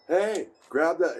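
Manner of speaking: urgent call. Retold or imitated. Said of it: "me and Dell are all".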